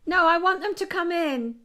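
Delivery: high, loud correction called across rooms; calling out. Real, not acted. Correcting a misunderstood request, calling out. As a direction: insist so they hear you through the wall. Calls correction to other room.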